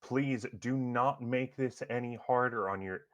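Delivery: lieutenant appealing to a crowd, voice lifted; urgent plea. Acted. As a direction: plead firmly as the arresting officer. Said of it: "as he's making this plea".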